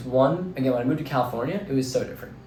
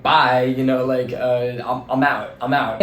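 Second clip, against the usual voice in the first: mock loud goodbye; 6 dB louder, slower than usual. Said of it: "It's like".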